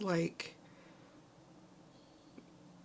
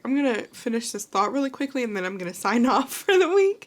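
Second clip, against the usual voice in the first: louder, laughing while crying; laughing through tears; 4 semitones higher, 8 dB louder than usual. Real, not acted. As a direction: pull it together through tears. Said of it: "I'm sorry".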